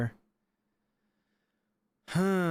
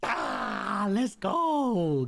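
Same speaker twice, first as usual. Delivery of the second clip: excited cheer; 6 semitones higher, much slower than usual. Real, not acted. Gamer celebrating a lucky win to viewers, excited cheer. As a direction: burst out as the shot lands.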